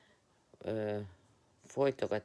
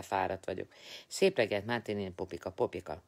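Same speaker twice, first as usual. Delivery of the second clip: ordinary talk, nothing marked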